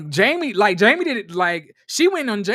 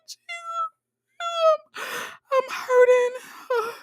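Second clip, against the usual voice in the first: very high, slow mock sob; Irena; much slower than usual. Imitating her collapsing into tears, hammed-up wail. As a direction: squeal it out as exaggerated, drawn-out crying. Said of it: "when she start crying".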